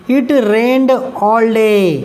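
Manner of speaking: slow, careful dictation of the example sentence; slow read-aloud. Shown as a demonstration. Teacher reading the example sentence slowly to students. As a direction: read it out word by word for the class.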